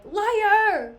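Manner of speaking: loud high shouted accusation; furious accusation. Acted. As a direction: hurl the word at her. Othello accuses Desdemona.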